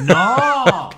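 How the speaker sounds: high, loud shouted no; loud protest. Real, not acted. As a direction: cut him off with a cry. Heated language argument protest.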